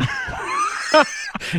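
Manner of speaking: high, shrieking laugh cracking into a shout; a howl of laughter at a ridiculous movie. Real, not acted. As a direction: crack up at the absurd ending. Laughs at the absurd scene.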